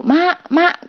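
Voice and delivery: high calls for mum; calling out. Retold or imitated. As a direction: call out to mum, scared. Calling mother in fear. (memanggil ibu dengan nada tinggi)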